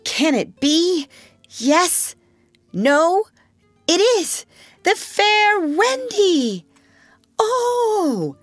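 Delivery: dramatic reveal. Acted. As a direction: ham up the grand reveal. Wendy's grand dramatic reveal.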